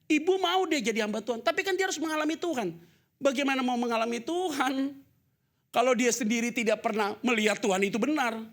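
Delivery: high, loud fiery sermon; full-force preaching. Acted. Preacher pressing the point hard to the congregation. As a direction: preach it with rising fire.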